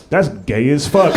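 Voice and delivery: mock yell, drawn out; imitated heckler yell. Old guy heckling from the back, drawn-out mock yell. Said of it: "He just yelled up".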